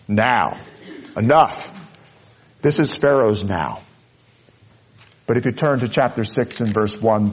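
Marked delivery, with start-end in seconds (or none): none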